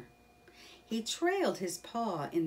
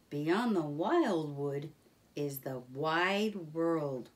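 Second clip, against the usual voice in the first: slow solemn Rat; slower than usual. Acted.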